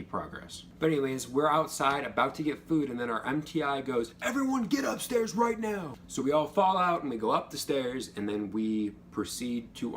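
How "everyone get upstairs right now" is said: drill instructor order. Retold or imitated. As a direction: yell it at the whole flight. MTI ordering everyone upstairs.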